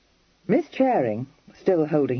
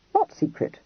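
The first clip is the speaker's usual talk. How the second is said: much higher, blank bewildered question; puzzled surprise. A narrator's reading. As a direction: blink and ask, caught off guard. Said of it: "said Freddy, blinking".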